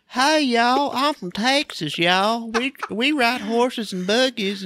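high, slow hammed-up Southern twang; mock Texan accent. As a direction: play the movie Texan cowboy. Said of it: "I go watch a movie and then I'll".

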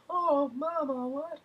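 very high falsetto whine; mock whiny son. Friend mocks the creepy son calling his mother, high whiny falsetto. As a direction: squeak it out like a mama's boy. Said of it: "Michael stands around going".

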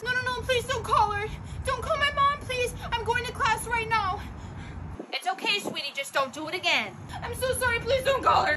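high, frantic begging; panicked pleading. Acted. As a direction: beg desperately, high voice. Student begging teacher not call mom.

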